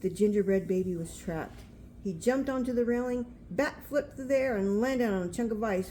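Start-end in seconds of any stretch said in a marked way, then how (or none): none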